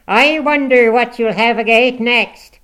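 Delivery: loud, high housewife in dialect; indignant, strident fussing over the victim; scolding tirade. A narrator's reading. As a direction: scold at full volume with slow, stretched phrases. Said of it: "turned her vocal artillery against".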